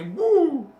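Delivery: high, loud whoop; excited woo. Real, not acted. To viewers, carried away by her own point, loud high whoop. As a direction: let out a sudden excited whoop. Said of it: "I got a little emotional".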